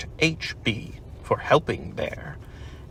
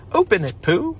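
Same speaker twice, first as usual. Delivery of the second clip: higher, louder eager chorus; eager urging; 9 semitones higher, 5 dB louder than usual. Acted. Party guests excitedly urge Pooh to open it. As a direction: urge him on, everyone at once.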